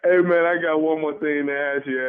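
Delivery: normal talking voice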